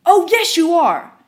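high, loud rabid shout, second slow; furious customer shrieking; screaming. Retold or imitated. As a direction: scream it waving the cup. Customer threatening.

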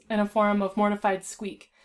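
plain reading voice

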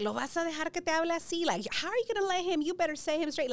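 high, loud, indignant mother's voice; Spanish mom pushing her to discipline the kid; scolding mom. Retold or imitated. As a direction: fire it off like an outraged abuela. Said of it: "my mom was like".

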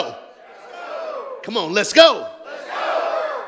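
shouted rally call; loud call to get going; hype shout. Real, not acted. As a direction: rouse the church, full voice. Hyping the crowd.

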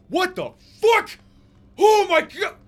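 very high, full-volume shriek; terrified screaming. Acted. Prisoner being attacked in his cell, screaming. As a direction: scream in sudden horror. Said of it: "this man starts to scream".

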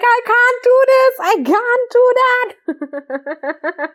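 high panicked yell; mock scream. Fleeing the naked friend, mock screaming. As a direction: wail it like running out screaming. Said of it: "I ran out of the room screaming".

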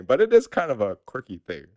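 ordinary talk

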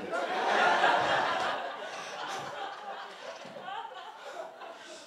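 long, high, airy burst of laughter; high breathless laugh. Real, not acted. Pastor laughing at his cringey old journal entry, breathless giggle.

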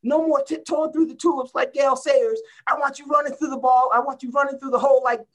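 high, strained and loud coach's rant; mock tirade of an old football coach; imitating coach yelling. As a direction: chew out a player on the field. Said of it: "He says".

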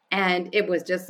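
ordinary talk, nothing marked

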